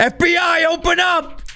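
high loud FBI agent shouting at a door; grave federal agent. Pretend. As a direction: bark it like a raiding agent. Mock FBI raid at door.

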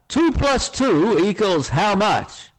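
high, slow, drawn-out quiz question; American boss announcing the big question; quizmaster's question. Acted. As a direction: pose it grandly, stretching each word.

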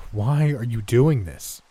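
loud, slow, desperate cry. A narrator's reading. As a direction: plead loudly and slowly. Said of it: "I cried".

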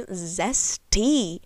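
"Zesty" stretched with a falling sass; drawn-out sassy "Zesty". Shown as a demonstration. On her dressing choice, a savoured "Zesty". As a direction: savour the word "Zesty" with attitude. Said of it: "I don't know why I said zesty like that".

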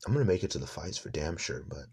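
ordinary talk, nothing marked

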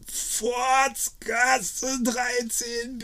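sehr hoch, langsam gedehnt gerufen; Schrei. Acted. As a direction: die Adresse quer über den Hof schreien. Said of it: "schrie er so laut er konnte". (schreit die Adresse, hoch und gedehnt)

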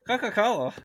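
giggling out "Coca-Cola" with no lip contact; laughing. Shown as a demonstration. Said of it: "say Coca-Cola without touching your lips".

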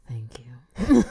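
breathy whispered thanks; whispering. Acted. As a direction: whisper it playfully. Whispers thanks after compliment.

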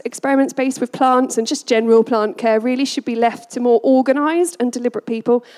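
ordinary talk

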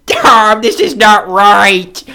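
distressed fan refusing the loss; distraught. Acted. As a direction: wail it slowly in disbelief. Distraught between heaving coughs.

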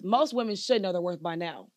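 ordinary delivery

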